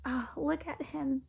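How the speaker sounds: ordinary delivery, nothing marked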